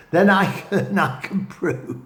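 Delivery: laughing through the words after tripping over "prove"; laughing at a slip. Real, not acted. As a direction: giggle and correct yourself, "I can prove". Laughs at his own slip.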